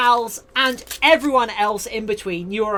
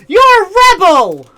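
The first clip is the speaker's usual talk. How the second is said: playful scolding; 7 semitones higher, 10 dB louder than usual. Pretend.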